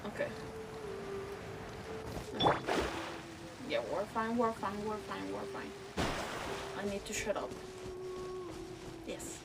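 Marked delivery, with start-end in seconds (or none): none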